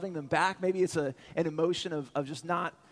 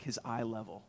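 usual speaking voice

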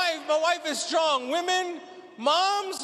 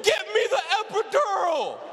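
mock scream, higher; screaming from the room; 5 semitones higher than usual. Wife in labor screaming for epidural, mock yell. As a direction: yell the demand from another room. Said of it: "she screams from the room".

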